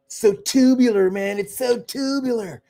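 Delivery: lightly put-on eighties dude voice; playful imitation of a surfer bro; mock surfer slang. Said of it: "the way Caucasian people used to talk".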